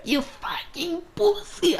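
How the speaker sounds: very high, drawn-out mock squeal; hammed-up falsetto insult, stretched out; exaggerated squeaky voice. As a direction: push the imitation up into the highest squeak. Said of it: "like, the highest pitched voice".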